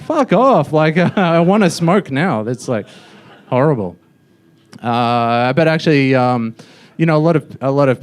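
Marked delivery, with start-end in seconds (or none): 0.0-2.4 high, loud comic outburst; mock exasperation. Passenger rebelling at the smoking announcement, mock exasperated. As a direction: blurt it out, half laughing.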